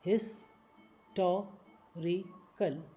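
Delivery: Historical stretched very slowly; slow syllable by syllable. Shown as a demonstration. Teacher slowing historical down for learners. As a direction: break historical into slow parts.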